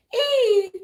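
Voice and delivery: high, loud imitated "hey"; imitating little Amir. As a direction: mimic a little kid piping up, drawn out. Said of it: "he's like".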